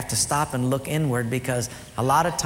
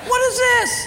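very high, loud disbelief; incredulous; 5 dB louder than usual. Retold or imitated. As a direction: gape in disbelief at the gift. Wife's silent look voiced.

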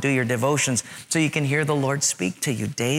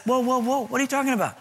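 lightly acted God, higher pitch; God's startled reply; 9 semitones higher, faster than usual. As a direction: cut in on a complainer, incredulous. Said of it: "You know what God says?".

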